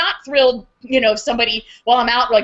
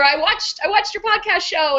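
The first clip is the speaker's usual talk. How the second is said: higher-pitched imitated enthusiasm; excited friend; 8 semitones higher than usual. Friend who watched her podcast, eagerly excited. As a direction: lightly mimic an eager fan.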